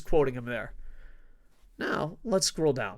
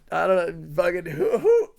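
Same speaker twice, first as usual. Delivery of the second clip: laughing; 6 dB louder than usual. Real, not acted. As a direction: laugh through the question. Laughing at betting odds.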